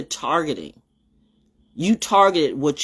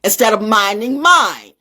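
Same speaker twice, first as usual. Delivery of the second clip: loud, high, drawn-out scolding; stern warning; 12 semitones higher, 6 dB louder than usual. Real, not acted. Warning meddlers to stay in their place, loud and stern. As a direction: raise the voice and stretch each word in warning. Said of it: "this is a warning to you".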